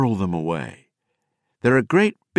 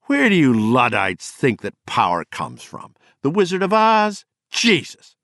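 put-on a drunk man's contempt, louder and higher at the end; angry rant; 5 semitones higher, 4 dB louder than usual. Gardner mocking the Luddite poets, scornful and rising. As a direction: lash out at them, climbing to a cry of Jesus.

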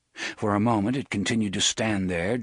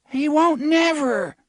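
put-on high, eager boy, louder; breathless with anticipation; agonized hope; 7 dB louder than usual. Third boy betting the dog won't bite, eager and high. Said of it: "in an agony of hope and anticipation".

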